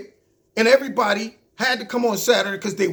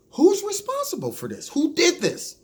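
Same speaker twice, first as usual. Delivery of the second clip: imitated questioner; 9 semitones higher than usual. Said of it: "asked him a sensible question".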